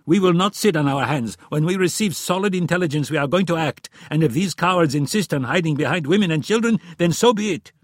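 loud, high, insistent tirade; heated argument. A narrator's reading. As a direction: press each point forcefully in frustration. Frustrated Friedman argues his case.

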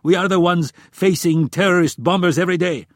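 loud, high, insistent tirade; heated argument. A narrator's reading. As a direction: press each point forcefully in frustration. Frustrated Friedman argues his case.

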